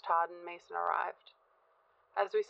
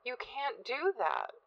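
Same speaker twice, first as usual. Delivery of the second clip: higher, drawn-out disbelief; flabbergasted; 6 semitones higher, slower than usual. A narrator's reading. Son told of a break from his friend, flabbergasted. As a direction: protest slowly, voice rising high in shock.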